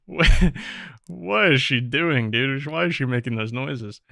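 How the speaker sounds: loud, incredulous reaction, slowing on the question; baffled outburst. Real, not acted. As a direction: blurt it out in disbelief. Baffled by game character's noises.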